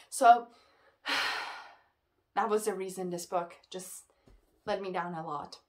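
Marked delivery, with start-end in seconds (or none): 1.0-1.6 a long breathy sigh of defeat; exasperated sigh. Real, not acted. Reviewer done ranting about the book, weary sigh. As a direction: let the air out, fed up.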